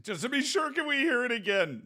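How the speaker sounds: high, pleading to hear it again; a cheeky fan begging for an encore; mock audience voice. Pretend chat begging for the plane noise, high cheeky voice.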